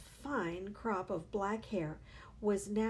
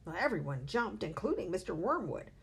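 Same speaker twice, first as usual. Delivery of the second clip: plain reading voice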